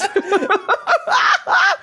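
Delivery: loud, high giddy laughter; giddy shriek of laughter; excited laugh. Real, not acted. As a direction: burst out laughing with glee. Laughing after excited outburst.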